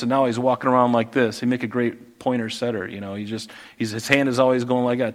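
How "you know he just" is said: normal talking voice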